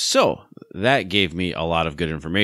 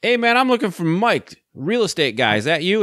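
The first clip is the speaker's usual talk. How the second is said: much higher, goofy put-on caller voice; stupid voice; 11 semitones higher than usual. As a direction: pretend to be a clueless stranger looking for Mike. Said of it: "I put on some stupid voice".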